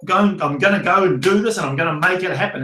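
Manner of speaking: ordinary delivery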